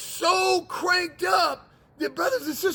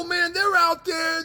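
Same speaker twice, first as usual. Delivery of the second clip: ordinary talk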